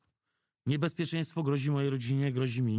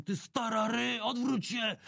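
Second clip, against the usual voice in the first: thug's shout; 8 semitones higher, slower than usual. Retold or imitated. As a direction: bark it at her like a street thug. Thugs yelling at old woman.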